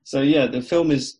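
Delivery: usual speaking voice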